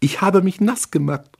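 put-on very high, girlish and giggly; childish giggly girl. A narrator's reading. Carmen confessing to the narrator in a high childish voice. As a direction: say it like a silly little girl.

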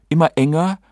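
ordinary delivery, nothing marked

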